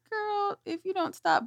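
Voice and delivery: a hint of an exasperated mom; mother warning her daughter, mock exasperation. Mock threat to her child.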